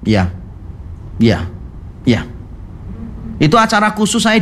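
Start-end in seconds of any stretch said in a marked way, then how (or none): 0.0-2.2 low, unsmiling replies, spaced out with pauses; unsmiling acknowledgement. Retold or imitated. Boss acknowledging bowing guests without a smile, imitated as aloof. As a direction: imitate a boss's low, curt, detached yes. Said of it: "Dia cuma kasih gini tok tanpa senyum".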